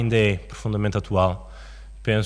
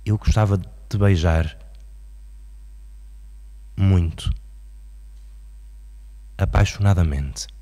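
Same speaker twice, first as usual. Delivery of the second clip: poem recitation; 2 semitones higher than usual. Acted. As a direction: declaim it as a poem, with long dramatic pauses.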